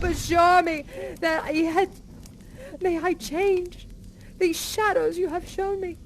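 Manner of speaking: frantic, tearful plea of a changed man; desperate Scrooge. Acted. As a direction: beg the spirit for another chance. Scrooge pleading with the spirit.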